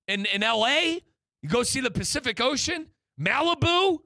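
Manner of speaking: hyped-up sales pitch. Real, not acted. Pitching Pep to come to US.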